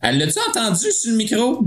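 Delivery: high, worried what-if; suddenly anxious, voice jumping up; alarmed inner thought. Retold or imitated. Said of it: "je suis comme".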